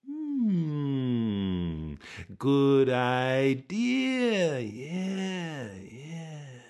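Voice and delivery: lightly voiced villager mulling it over; villager musing. A narrator's reading. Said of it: "Somebody else said".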